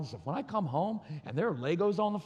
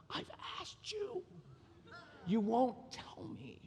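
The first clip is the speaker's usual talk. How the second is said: very high, soft, slow strained voice; inner exasperated cry; 6 semitones higher, 7 dB quieter, slower than usual. Retold or imitated. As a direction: squeal it slowly under your breath, mock exasperation. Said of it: "And in my head, I'm like".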